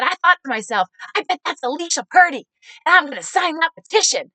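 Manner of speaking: imitated gushing, high and loud; excited supporter. Supporter greeting the candidate from his truck, excited.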